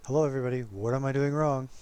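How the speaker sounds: loud, self-mocking call-out; mock announcement. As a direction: call it out loudly, ribbing yourself. Mocking own mistake in replay.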